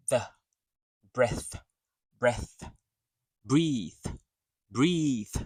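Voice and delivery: "breath" short with airy th, "breathe" longer with voiced th. Shown as a demonstration. Teacher showing the breath in it, airy TH. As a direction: let air through the th in each word. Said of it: "But there's a breath to it".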